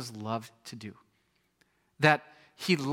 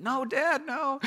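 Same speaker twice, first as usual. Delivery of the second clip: put-on much higher, whiny little girl's refusal; imitating little daughter; 11 semitones higher, slower than usual. Preacher voices daughter refusing to open the door. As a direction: whine it like a sore four-year-old. Voicing his four-year-old daughter.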